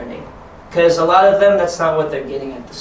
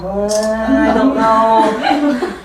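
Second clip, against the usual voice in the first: drawn-out, unsure whine, higher; hesitant kid; 4 semitones higher, much slower than usual. Acted. Teacher imitating kids unsure of the game, slow hesitant voice. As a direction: stretch it out very slowly, mock-reluctant. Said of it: "where they're already a little".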